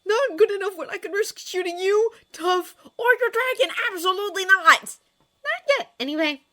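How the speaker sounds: high, loud indignant sputter. A narrator's reading. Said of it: "Hiccup sputtered".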